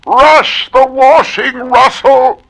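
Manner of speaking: high, slow call across the house to Russell; shrill call. Acted. Woman calling Russell to hurry, high and slow. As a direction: sing out his name up high.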